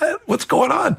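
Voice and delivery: high, puzzled what's going on; retold bewilderment. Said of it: "I'm like".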